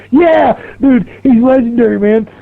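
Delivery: high-pitched, enthusiastic quoted reply; high, admiring retold voice; excited coworker voice. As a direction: imitate a hyped-up buddy. Coworker praising the local legend.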